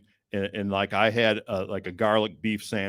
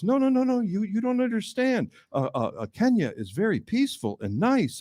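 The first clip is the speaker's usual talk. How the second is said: protesting driver; 13 semitones higher than usual. Retold or imitated.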